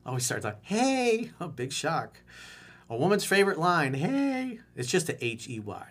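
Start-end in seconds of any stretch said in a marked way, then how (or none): 0.6-1.3 high put-on girly voice; girl voice. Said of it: "Let me get my girl voice ready". 3.9-4.6 high put-on girly voice; girl voice. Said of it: "Let me get my girl voice ready".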